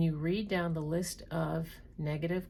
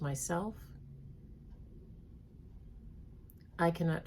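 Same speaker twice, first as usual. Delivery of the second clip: read slowly; 4 dB quieter than usual. Shown as a demonstration. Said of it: "I'm gonna read them slowly".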